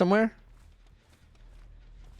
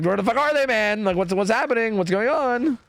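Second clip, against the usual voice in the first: mock stressed killer; 8 semitones higher than usual. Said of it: "He's just like".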